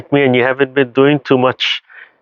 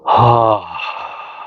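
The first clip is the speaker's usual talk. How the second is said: demo sigh. Shown as a demonstration. Teacher showing the class a yawning ha exhale. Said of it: "that yawn kind of mechanism and that ha sound".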